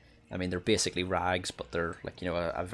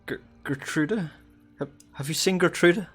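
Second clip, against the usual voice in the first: put-on much higher, desperate mother calling for her lost child; haggard sobbing mother; 10 semitones higher, 6 dB louder than usual. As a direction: cry out for your missing daughter, raw from weeping. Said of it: "like, very haggard".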